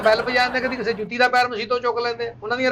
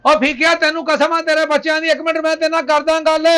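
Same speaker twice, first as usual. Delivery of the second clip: higher and louder, begging him to wait; pleading shout; 6 semitones higher, 4 dB louder than usual. Acted. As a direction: call after him with urgency. (ਉੱਚੀ ਆਵਾਜ਼ 'ਚ ਤਰਲਾ)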